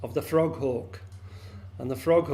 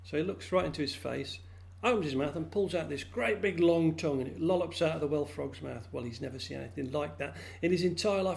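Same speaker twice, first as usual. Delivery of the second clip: usual speaking voice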